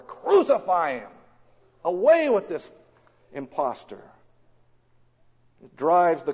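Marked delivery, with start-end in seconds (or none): 0.0-4.0 the crowd demanding death from Pilate, shouting; crowd crying out. Acted. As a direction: bellow it like a frenzied mob. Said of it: "they cry out all the more".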